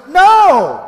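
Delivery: high, loud panicked cry of no; a sudden, loud, high scream; retold shout of refusal. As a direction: yell it out as you bail. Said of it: "and I said".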